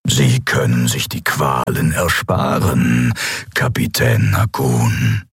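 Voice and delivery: low, slow coaxing menace at first, then measured offer; imperious emperor. Acted. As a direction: offer mercy as an unhurried threat. Figur des Kaisers. (herrischer Kaiser, anfangs tief und langsam)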